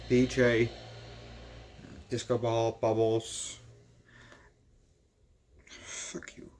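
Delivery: normal talking voice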